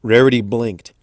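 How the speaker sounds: plain reading voice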